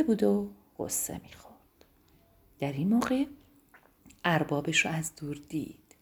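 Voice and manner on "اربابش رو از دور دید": usual speaking voice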